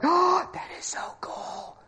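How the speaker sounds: high breathy wonder, nearly unvoiced; awestruck whisper. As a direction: gush it in hushed amazement. Jaw fell open again.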